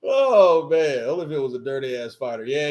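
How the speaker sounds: normal talking voice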